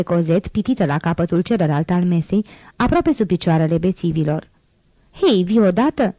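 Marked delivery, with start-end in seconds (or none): 5.1-6.1 impatient call, kept restrained; a mild narrated shout at someone. A narrator's reading. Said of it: "strigă Thenardiera". (strigăt reținut, nerăbdător)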